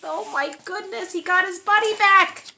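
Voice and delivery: ordinary delivery, nothing marked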